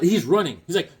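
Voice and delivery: ordinary delivery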